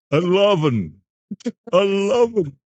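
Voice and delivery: high Scottish "eleven", falling at the end; comic Scottish accent, pitched well up. Acted. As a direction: imitate an exasperated Scotsman at a voice lift. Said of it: "a good idea in Scotland".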